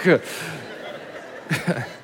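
a soft unvoiced laugh; a quiet breathy chuckle. Real, not acted. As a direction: chuckle at the memory. Laughs at the story.

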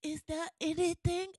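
a mock Melania, badly faked; imitating Melania. As Melania asking Michelle, a botched accent. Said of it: "that wasn't even her accent".

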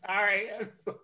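high, soft apology through giggles; helpless laughter. Real, not acted. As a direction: squeak out an apology while cracking up. Helpless laughing fit while apologizing.

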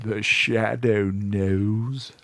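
very slow, drawn-out tagline; the Shadow. Retold or imitated. Proposing a podcast intro, imitated Shadow voice. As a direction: stretch the words like a radio intro. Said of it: "It was a voice just like that".

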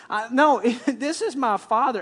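ordinary delivery

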